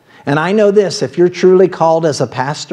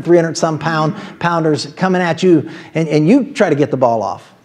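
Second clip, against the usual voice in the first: ordinary delivery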